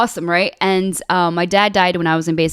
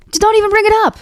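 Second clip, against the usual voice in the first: high-pitched exasperated plea; exasperated dismissal; 13 semitones higher, 4 dB louder, much faster than usual. Real, not acted. Telling almost-joined guys to drop it, exasperated. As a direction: shut the subject down, voice pitched up.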